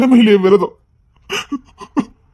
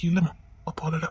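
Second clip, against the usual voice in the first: hushed denial; 5 semitones lower, 12 dB quieter than usual. Acted. Father denies crying, hushed.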